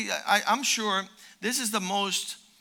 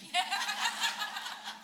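laugh; 9 semitones higher, 10 dB quieter than usual. Real, not acted. Laugh after grandchildren joke.

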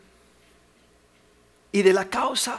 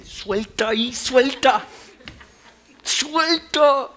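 slow command rising to a loud higher shout; building shout; 8 semitones higher, 7 dB louder, much slower than usual. Acted. Shepherd forcing the wolf to release its prey, building shout. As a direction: order the wolf to let go, louder each time. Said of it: "hacía soltar a la presa".

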